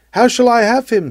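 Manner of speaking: anxious seeker's question. Retold or imitated. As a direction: cry it out like a troubled seeker. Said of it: "says one".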